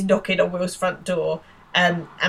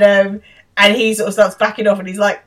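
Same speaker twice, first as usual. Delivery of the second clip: ordinary talk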